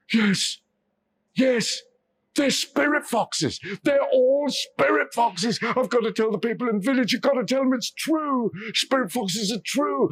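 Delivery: frantic discovery; excited realization. Acted. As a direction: Ryuichi shouting his revelation. Man realizes foxes are real.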